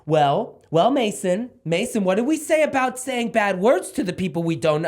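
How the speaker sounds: high-pitched, over-patient parent voice; mock a parent gently reasoning with a child. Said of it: "you see parents go".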